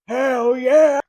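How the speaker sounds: big loud whoop of approval; excited cheer. Retold or imitated. Bookstore owner cheering at her horror purchase, loud and thrilled. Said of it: "the owner was like".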